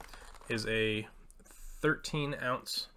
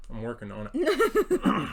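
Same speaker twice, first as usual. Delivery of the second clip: laughing; 5 semitones higher, 6 dB louder, slower than usual. Real, not acted. Chewing, laughing at question.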